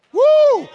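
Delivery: high, loud preacher's whoop; ecstatic whoop. Real, not acted. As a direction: let out a joyful holler.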